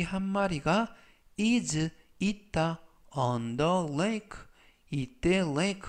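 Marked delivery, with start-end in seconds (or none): 1.4-4.4 very slow, careful English; slow model reading. Shown as a demonstration. Teacher reading example slowly to class. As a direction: stretch each word for learners. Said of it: "활용 문장 보시겠습니다".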